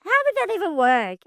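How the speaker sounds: high, sharp what of disbelief; stunned what. Real, not acted. Host reacting in disbelief to guest's story, high startled what. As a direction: blurt it out in disbelief.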